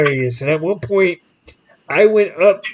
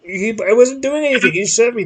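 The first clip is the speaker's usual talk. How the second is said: put-on higher, upset, insistent kid; indignant protest; 6 semitones higher than usual. Upset teen insisting to his dad that the guard shoved him. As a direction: make Dad see the injustice.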